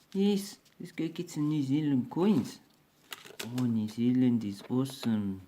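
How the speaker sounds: exaggerated Kiwi accent, bright; hammy New Zealand accent. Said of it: "my New Zealand accent".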